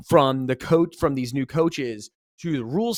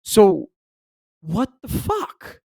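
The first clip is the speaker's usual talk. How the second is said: stunned disbelief; 6 semitones higher, 6 dB louder, much slower than usual. Retold or imitated. Retold reaction to seeing emails.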